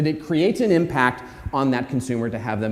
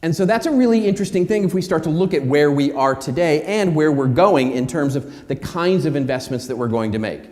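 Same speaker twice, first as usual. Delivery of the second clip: usual speaking voice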